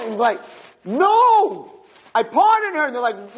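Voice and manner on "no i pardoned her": very high, frantic acted cry; desperate shout. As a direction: burst in shouting to stop it. Husband bursts in to stop execution.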